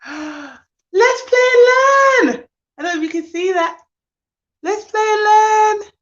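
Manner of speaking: real thrilled announcement; excited reveal. Real, not acted. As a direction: draw the winner's name out in delight. Reading out the winner.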